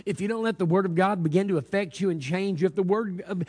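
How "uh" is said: ordinary talk, nothing marked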